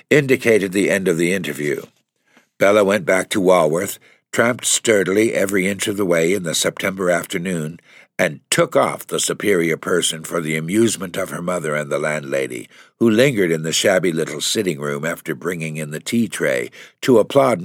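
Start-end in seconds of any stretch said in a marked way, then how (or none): none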